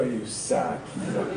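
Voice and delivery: whispered slow scornful insult; whispered sneer. Retold or imitated. Young man whispers scorn at middle-aged dog walkers. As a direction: hiss it under your breath, mocking. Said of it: "and I go".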